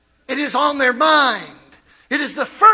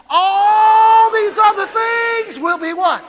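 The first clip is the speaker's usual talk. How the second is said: higher, louder, slow and ringing; slow ringing shout; 6 semitones higher, 7 dB louder, slower than usual. Shown as a demonstration. Preacher calls the church to answer, ringing shout. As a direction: lift it to the rafters. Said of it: "do it like me".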